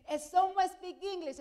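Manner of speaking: high, loud repeated call; calling out again. Retold or imitated. Doctor asks again, louder.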